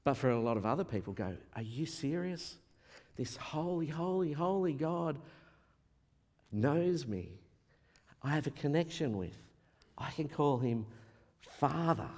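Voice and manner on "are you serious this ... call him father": hushed, awestruck wonder; soft amazement with long pauses; joyful disbelief. Retold or imitated. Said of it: "It brought joy to them".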